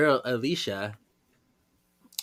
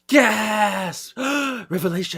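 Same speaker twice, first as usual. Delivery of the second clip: theatrical gasping amazement, pitched up; mock shock; 8 semitones higher, 3 dB louder, much slower than usual. Friend reacts to the reveal, mock shocked gasps. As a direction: gasp and play stunned, hammed up.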